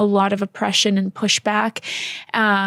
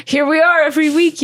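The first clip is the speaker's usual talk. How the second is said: higher, louder playful announcement; playful exclaim; 6 semitones higher, 4 dB louder than usual. Real, not acted. Joking to viewers about weekly episodes, playful and loud. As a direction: sell it like a cheeky punchline.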